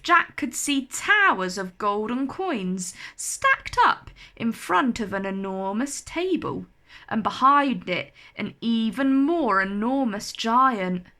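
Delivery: ordinary delivery, nothing marked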